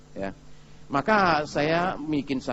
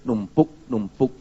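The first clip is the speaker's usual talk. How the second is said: slow piling up; 5 semitones lower, slower than usual. Shown as a demonstration. Anger piling up.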